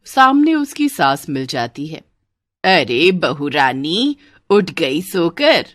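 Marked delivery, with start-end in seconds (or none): 2.6-5.7 mother-in-law. Acted. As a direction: draw it out as the saas greeting her bride. Mother-in-law greets new bride. (सास की धीमी, भारी आवाज़)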